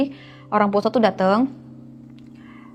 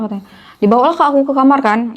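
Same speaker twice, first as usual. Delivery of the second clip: plain reading voice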